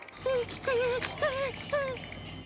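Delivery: high nervous giggle; nervous laugh. Acted. Nervous laugh during movie.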